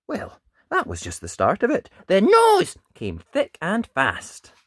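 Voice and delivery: put-on lively read-aloud voice for children; animated picture-book narration; storybook reading. A narrator's reading. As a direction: tell it like bedtime story time.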